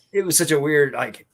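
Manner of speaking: ordinary delivery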